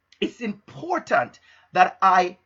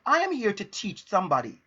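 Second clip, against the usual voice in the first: ordinary talk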